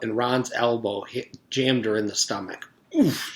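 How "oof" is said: sharp high grunt of pain; knocked breathless, a sudden oof. Acted. Allison hit in the stomach on the stairs, grunting. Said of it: "she grunted".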